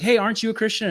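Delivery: high-pitched mock challenge; challenging peer. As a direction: imitate a peer calling someone out. Voices hostile peers questioning youth.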